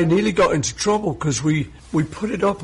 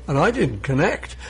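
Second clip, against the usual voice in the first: ordinary talk, nothing marked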